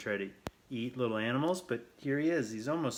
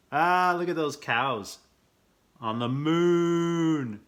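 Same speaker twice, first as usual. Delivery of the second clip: higher and louder, drawn out with delight; delighted cry; 8 semitones higher, 10 dB louder, slower than usual. Real, not acted. Reader delighting at cows on the moon, thrilled. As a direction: marvel aloud at the picture, stretching moon.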